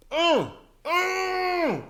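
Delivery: ordinary talk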